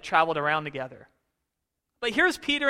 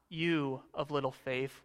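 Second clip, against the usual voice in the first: slow, softer, let down "You of little faith"; disappointed rebuke; 6 semitones lower, 9 dB quieter, slower than usual. Shown as a demonstration. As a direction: scold him with quiet disappointment. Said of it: "What if it's not".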